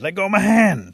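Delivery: high, loud storybook yell; shouted demand. A narrator's reading. Rabbit stuck to the tar baby, yelling to be released. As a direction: yell it as if yanking free.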